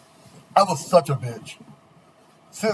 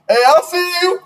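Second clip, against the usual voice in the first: high, loud call from the back; retold yell; 7 dB louder than usual. Classmates calling him out from the back, yelling. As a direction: shout it across the classroom.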